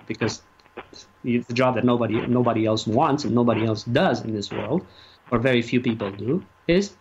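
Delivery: ordinary talk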